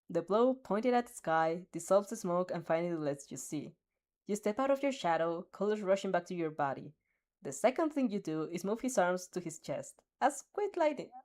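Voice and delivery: plain reading voice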